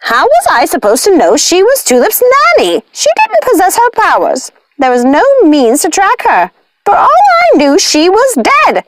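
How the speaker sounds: high, exasperated witch voice. A narrator's reading. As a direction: defend herself through clenched patience. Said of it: "willing herself not to strangle".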